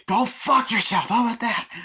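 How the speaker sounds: high mock-raging yell; furious retort. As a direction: spit it at the operator, hammed-up. Retold argument with car operator.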